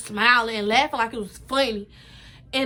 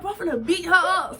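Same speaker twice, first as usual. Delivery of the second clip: angry threat; 6 semitones higher, 3 dB louder than usual. Retold or imitated. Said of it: "I was so mad".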